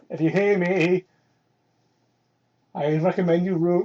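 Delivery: normal talking voice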